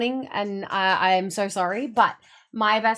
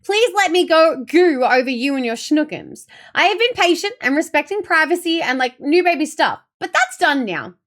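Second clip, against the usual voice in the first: loud, raised-pitch playful tirade; mock indignation; 5 semitones higher, 6 dB louder than usual. Scolding best friend for withholding baby news, mock indignant. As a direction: demand baby news in hammed-up outrage. Acting out playful demanding message.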